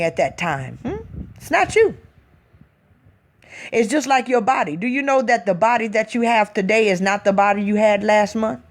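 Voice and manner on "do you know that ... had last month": ordinary talk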